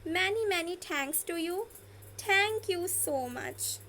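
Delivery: slow, clear thanks; slow demo. Shown as a demonstration. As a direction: say each word slowly. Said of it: "ये sentences भी use कर सकते हैं".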